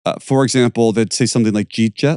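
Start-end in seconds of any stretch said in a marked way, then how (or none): 1.7-2.2 mock Pittsburgh accent, words run together; clipped Yinzer slur of "Jeet Jet"; Pittsburghese. Shown as a demonstration. Pittsburgh accent for family listeners. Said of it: "I'm terrible at impersonating it, but I'll try".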